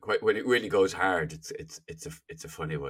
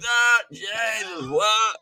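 high-pitched, drawn-out over-the-top Irish; exaggerated Irish accent; 14 semitones higher, 3 dB louder, much slower than usual. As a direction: put on a wildly hammed-up Irish accent. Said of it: "suddenly sound ten times more Irish".

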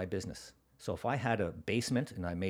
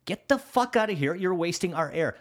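raised, high-pitched mock tirade; harsh dismissal; 8 semitones higher, 5 dB louder than usual. Program lady throwing a would-be store owner out, berating. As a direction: imitate a tough boss throwing someone out. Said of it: "That's exactly how she spoke".

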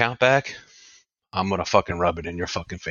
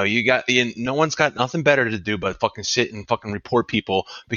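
normal talking voice

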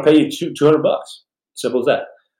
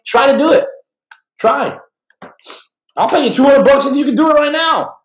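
challenge shout; 3 dB louder than usual. Real, not acted. Daring viewers to try.

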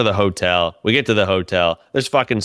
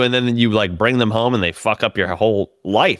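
ordinary delivery

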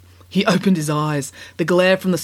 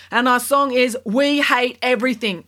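announcing Kat voice; 7 semitones higher than usual. A narrator's reading. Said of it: "announced Kat".

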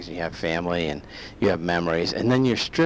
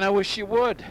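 high mocking taunt; 12 semitones higher than usual.

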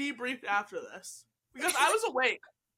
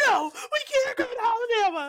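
mock whiny shout; 8 semitones higher than usual. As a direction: cry out a hammed-up no. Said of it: "And Jazz was like".

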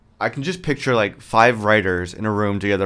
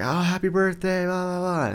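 chirpy coworker voice; 9 semitones higher than usual. Retold or imitated. Mocking coworkers' greeting.

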